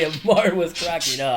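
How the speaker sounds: ordinary delivery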